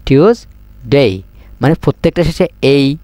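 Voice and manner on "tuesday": টিউসডে slowly, split into "tyoos" and "dey"; slow model TUESDAY. Shown as a demonstration. Teacher modelling for students, slow, split টিউসডে. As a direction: draw out টিউসডে syllable by syllable. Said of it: "এবারে হবে না টিউসডে".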